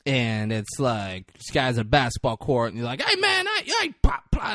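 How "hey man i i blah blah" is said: mock rapper voice. Rapper in music video, retold.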